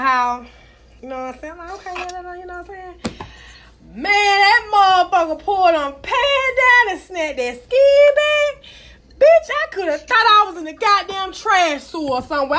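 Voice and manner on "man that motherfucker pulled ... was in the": high and loud, almost crying with disgust; outraged shriek. Real, not acted. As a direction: yelp it out in horror. Outraged retelling, near tears.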